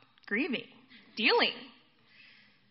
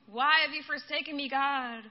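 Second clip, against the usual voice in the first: lamenting complaint; 3 semitones higher than usual. Acted. Psalmist asks God why the faithful have nothing, lamenting. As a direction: wail the complaint, acted out. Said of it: "laments and complaints to God".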